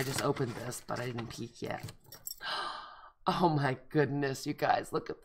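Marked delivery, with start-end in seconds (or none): 2.0-3.3 soft gasp of delight; quick breath in at a pretty card; surprised gasp. Real, not acted. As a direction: gasp at the first peek. Peeking into envelope.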